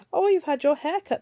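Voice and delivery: high, loud mocking sing-song; a poem reader voicing the teasers; teasing classmates. A narrator's reading. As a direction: tease like a schoolchild.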